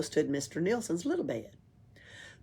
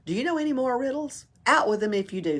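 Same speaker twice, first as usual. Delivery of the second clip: encouraging; 7 semitones higher, faster than usual. Acted. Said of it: "said Pippi encouragingly".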